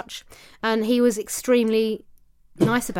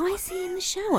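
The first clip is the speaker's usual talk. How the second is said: puzzled inner voice; 10 semitones higher, 4 dB quieter than usual. Retold or imitated. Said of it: "And I was like".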